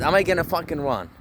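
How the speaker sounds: ordinary talk, nothing marked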